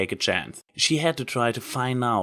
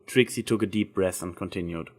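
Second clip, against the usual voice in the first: plain reading voice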